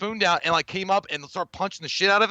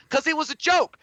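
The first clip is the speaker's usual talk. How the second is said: mock exasperated protest; 12 semitones higher than usual. Acted exasperated reply to touchy classmate.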